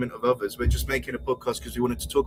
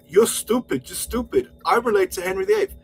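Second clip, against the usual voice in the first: higher-pitched mocking take on a rival; mock quote; 9 semitones higher than usual. Quoting a rival's claim to royal descent, mocking. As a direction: parrot his boast in a raised, scornful voice. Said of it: "when he's saying".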